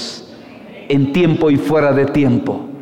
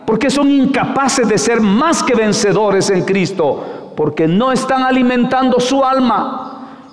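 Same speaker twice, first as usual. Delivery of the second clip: much higher, fervent rebuke; preaching at full force; 8 semitones higher than usual. Real, not acted. Preacher rebukes a weak congregation in a fervent high cry. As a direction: call the flock out with fire.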